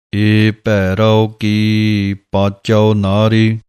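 slow, sung-through recitation of gurbani; scripture recitation. Shown as a demonstration. Teacher recites the verses slowly for students. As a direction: chant the verse slowly and evenly.

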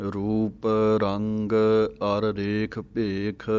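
measured, slow recitation of gurbani verse; slow scripture recitation. Shown as a demonstration. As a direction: chant each word slowly and evenly for the class. Recites verse slowly for students. (ਹੌਲੀ ਹੌਲੀ ਪਾਠ ਦਾ ਉਚਾਰਨ)

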